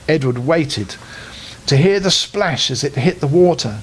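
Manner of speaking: ordinary delivery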